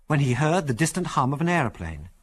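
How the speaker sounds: plain reading voice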